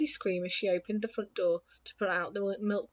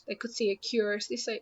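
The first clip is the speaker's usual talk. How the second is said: usual speaking voice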